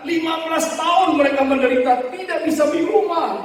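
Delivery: high, insistent preaching swell; impassioned lament. Retold or imitated. Preacher retelling a pastor's fifteen years of suffering, impassioned lament. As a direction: build the hardship up in a high, forceful voice.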